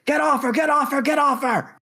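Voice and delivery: high, frantic repeated shout; urgent shouting. Acted. Snake shouting from downstairs to get someone off her, urgent shout. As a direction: yell it like a desperate rescue. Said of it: "you hear Snake shouting from downstairs".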